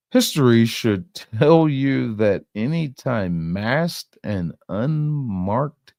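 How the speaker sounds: very slow, dragged-out reading aloud; slow mocking read. Shown as a demonstration. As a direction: drag each word out in disbelief. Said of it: "let's try to make sense of this".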